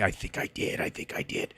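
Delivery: guilty whisper, no voice at all. Real, not acted. Streamer realizing he slipped up on stream, whispering. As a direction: breathe it out like a confession.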